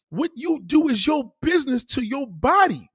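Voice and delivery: high, insistent lecturing; emphatic retold telling-off. Telling an anti-vaxxer it's her business, emphatic and high.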